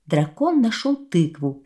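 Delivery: ordinary delivery, nothing marked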